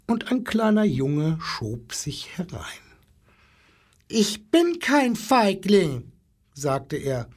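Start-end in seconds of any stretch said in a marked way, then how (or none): 4.1-6.1 put-on high, slow child voice; lightly voiced small boy standing up for himself; little boy protesting. A narrator's reading. Small boy facing zoo animals, high-voiced protest.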